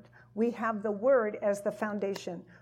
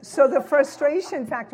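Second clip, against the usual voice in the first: louder restart; 3 semitones higher, 9 dB louder than usual. Real, not acted. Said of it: "restarts topic after interruption".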